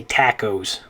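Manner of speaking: tacos as TACK-ohz; anglicized mispronunciation. Shown as a demonstration. Teacher imagines an English word, tacos said TACK-ohz. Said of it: "if it was an English word".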